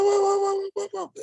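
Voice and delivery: high, startled whoas in a rush; shocked whoa. Retold or imitated. Retelling her startled reaction to the jail call, high alarmed whoa. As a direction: caught off guard, pull back in alarm.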